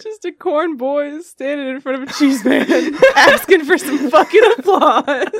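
words broken by real laughter; laughing while talking. Real, not acted. Laughing at the image of a corn boy begging for applause. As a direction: giggle through the line.

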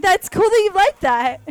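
mock polite. Mock polite reply to fetish.

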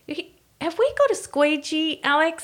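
high, sing-song innocent question to husband; sweet hinting ask. Retold or imitated. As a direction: ask sweetly, fishing for help. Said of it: "And I went".

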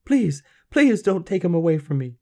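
high, loud, frantic plea; begging. A narrator's reading. Girl begging for her baby, desperate. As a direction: beg desperately as a frightened mother. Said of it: "she begged".

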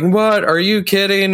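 high, startled what; confused surprise. Retold or imitated. Kyle, suddenly back to his senses, baffled and high. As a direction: play it baffled, the voice jumping up. Kyle told they tried killing him.

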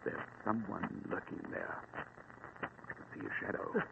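slow, hushed whisper. Acted. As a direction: whisper so the lurker won't hear. Intruder spotted on the steps.

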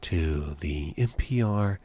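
low, slow, hushed radio-host delivery; drawn-out opening, then low and measured; mock public-radio host. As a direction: spoof a sleepy radio intro, long pauses between phrases. Spoofs public-radio hosting.

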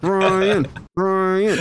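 lightly voiced dummy, lips still; a hint of a ventriloquist dummy. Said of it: "My lips aren't moving".

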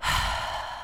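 long, breathy acted sigh; imitated weary sigh, all air; heavy sigh. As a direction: let out a heavy, put-on sigh. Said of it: "until she sighs heavily".

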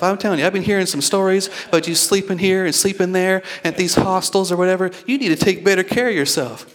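high-pitched, acted motherly telling-off; worked-up mother. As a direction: lecture your son in worried exasperation. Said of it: "worked up".

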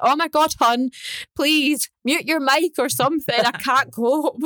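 high, exasperated mock pleading; exasperated plea. Listener to noisy meditation guide, exasperated plea. Acts out exasperation at mouth noises.

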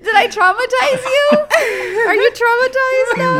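high, laughing while asking. Real, not acted. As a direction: giggle through the question. Laughing question after joke.

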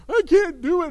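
high, loud mock toddler whine; whining child. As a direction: wail it like a kid on the floor. Child's tantrum voiced.

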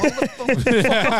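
high, slow disbelieving cry; what the hell, stretched out high; exasperated outburst. Retold or imitated. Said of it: "I was like".

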